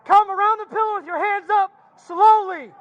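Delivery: high, firm, slow command; shouted surrender demands. Real, not acted. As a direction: call the orders out slowly. Police ordering person out.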